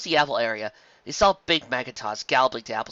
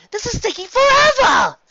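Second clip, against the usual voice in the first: exasperated outburst; 11 dB louder than usual. Acted. Carrot Top complaining at the wait.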